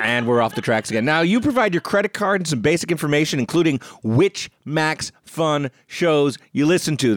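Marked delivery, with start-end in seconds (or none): 3.4-7.2 "including which Max Fun shows you listen to" said in slow, separated chunks, word by word; staccato. Real, not acted. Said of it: "The reason I'm speaking in this staccato manner".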